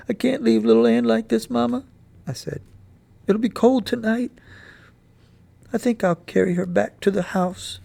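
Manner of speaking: put-on boy asking mother to carry his dog home; pleading boy. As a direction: plead to take her home.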